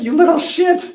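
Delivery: high, loud, cracking with laughter; dad outwitted by his son, laughing hard. Real, not acted. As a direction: burst it out through real laughter. Laughing at being outplayed by son.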